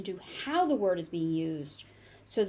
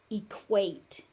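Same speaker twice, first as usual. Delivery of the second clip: ordinary talk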